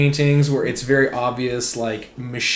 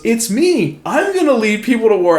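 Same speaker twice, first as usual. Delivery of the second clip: higher, proud tsar boasting; teacher voicing a cartoon tsar, higher and boastful; pompous tsar; 10 semitones higher, 6 dB louder than usual. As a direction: puff up as the vain tsar Nicholas. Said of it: "And he goes".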